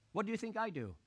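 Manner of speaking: ordinary talk, nothing marked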